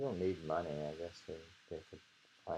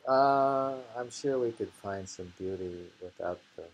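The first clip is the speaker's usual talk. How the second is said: ordinary talk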